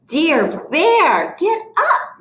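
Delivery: high-pitched calling out; mild storybook shout. A narrator's reading. Mouse wakes Bear in the story, mild high shout. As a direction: call to wake a sleeper, pitch leaping up. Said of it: "Mouse shouts in his ear".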